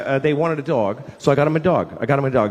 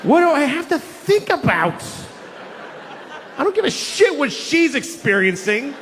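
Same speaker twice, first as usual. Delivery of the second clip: higher, fed-up ranting; exasperated rant; 9 semitones higher than usual. Acted. Comic ranting to the audience about the dog. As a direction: let the frustration boil over.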